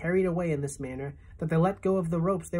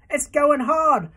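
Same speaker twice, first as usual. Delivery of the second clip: desperate moan; 10 semitones higher, 7 dB louder than usual. A narrator's reading. Centipede laments his stiffening legs, high and anguished. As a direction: despair at being stuck. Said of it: "he moaned".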